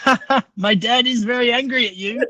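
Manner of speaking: high-pitched imitation of an amused young boy; laughing kid. Eight-year-old son translating dad's anger to stranger, laughing kid voice. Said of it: "he's laughing his ass off because he's like".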